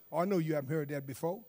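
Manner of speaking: ordinary talk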